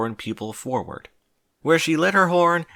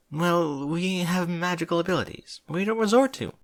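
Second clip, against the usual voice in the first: higher princess voice; lightly voiced princess; 9 semitones higher than usual. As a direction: answer hesitantly as a princess in a higher voice. Celestia's hesitant defensive answer.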